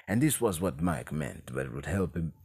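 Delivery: low, hushed put-on character voice; whispery Banderas-type impersonation. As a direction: imitate an actor who whispers all the time. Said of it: "ends up with him whispering all the time".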